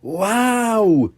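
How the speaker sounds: high amazed wow; high excited family wow; gasped wow. Acted. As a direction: gasp in wonder. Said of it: "gasped Pepper".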